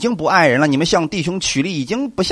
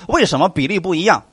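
ordinary talk, nothing marked

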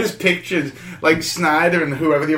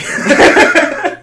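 high giddy laugh; 12 semitones higher, 9 dB louder than usual. Real, not acted. Starstruck meeting Kevin Smith.

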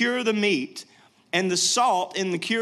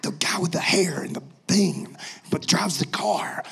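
hushed, fumbling explanation; explaining to his wife under his breath; whisper. Retold or imitated. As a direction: whisper it, groping for words.

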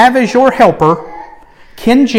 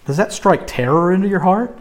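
ordinary talk, nothing marked

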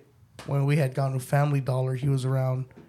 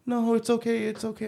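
high-pitched mock soothing; imitated reassurance; 7 semitones higher than usual. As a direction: play the stranger excusing the child, pitched up. Store guy reassuring the crying child.

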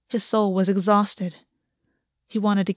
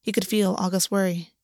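plain reading voice

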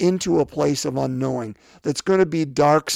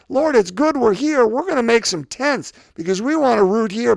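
high, bright-eyed excitement, a bit louder; eager Peter; 6 semitones higher, 3 dB louder than usual. Retold or imitated. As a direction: Peter gushing about staying. Peter voiced, eager on mountain.

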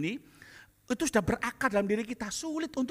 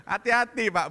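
usual speaking voice

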